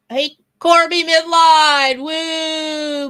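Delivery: high, loud, delighted greeting; excited shout. Real, not acted. Greeting a favourite viewer in the chat, thrilled and loud. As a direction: belt out a big happy hello.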